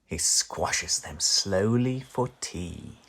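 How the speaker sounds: ordinary delivery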